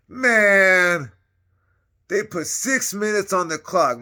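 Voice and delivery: high, loud and slow; loud disbelief. Real, not acted. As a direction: hammer home six minutes in disbelief. Incredulous emphasis on six minutes.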